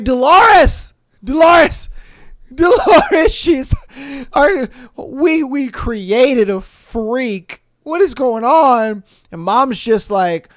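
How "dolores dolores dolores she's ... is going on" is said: much higher, loud repeated calls of Dolores; hammed-up frantic husband calling Dolores; mock panicked calling. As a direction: yell for your wife in horror. Dad calling wife in panic.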